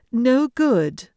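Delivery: high, loud princess voice; sharp haughty princess dismissal; cartoon princess. A narrator's reading. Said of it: "said the princess".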